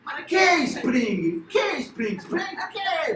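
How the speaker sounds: high, loud, slow Italian outburst; exasperated Italian. Retold or imitated. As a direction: throw up your hands like a Roman. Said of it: "os italianos tá morrendo de dar risada".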